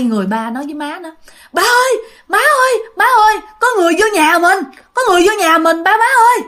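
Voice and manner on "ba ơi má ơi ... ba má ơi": high-pitched panicked alarm; terrified child calling parents. Retold or imitated. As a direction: wake the parents with urgent cries. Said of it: "nó sợ quá các bạn".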